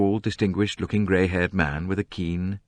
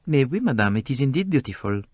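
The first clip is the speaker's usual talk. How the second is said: put-on polite Belgian-French detective accent; courteous French-accented reply; gentle French accent, very polite; 7 semitones higher than usual. A narrator's reading. Poirot voiced with French accent.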